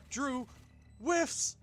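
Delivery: sudden high, loud exclamation; excited. Real, not acted. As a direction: burst out as the shot misses. Caster reacts to a miss.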